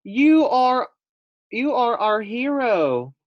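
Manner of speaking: high, loud, slow hero cheer; adoring squeaky praise; excited praise. Acted. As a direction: gush over your hero. Guinea pigs praising Fluffy.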